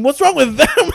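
high, loud retort through laughter; laughing retort. Retold or imitated. Teller mocking his own comeback to the officer, laughing. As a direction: throw it back, laughing.

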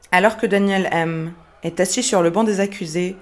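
normal talking voice

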